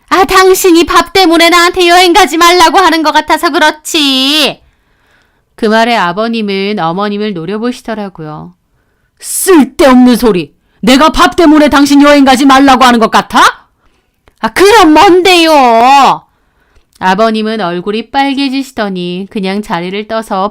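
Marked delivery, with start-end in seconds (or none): 0.1-4.6 put-on higher, complaining older wife; mother-in-law voice. Mother-in-law complaining to her husband. 9.2-13.7 louder, gruff rebuke; annoyed father-in-law. Acted. Father-in-law snapping at his wife. 14.4-16.3 slow, drawn-out, louder retort; sarcastic challenge. Acted. Wife challenging her husband, drawn-out.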